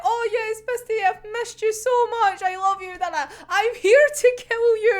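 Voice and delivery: high, gushing reunion voice; gushing mock greeting. Voicing a character greeting Misty, high mock gushing.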